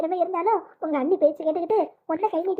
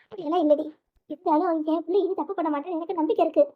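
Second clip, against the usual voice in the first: ordinary talk, nothing marked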